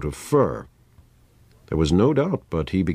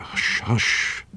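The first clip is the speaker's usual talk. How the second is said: whispered plea for silence; hushed, voiceless repeat; urgent hushing; 3 semitones higher than usual. A narrator's reading. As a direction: shush them under your breath. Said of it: "exclaimed the doctor".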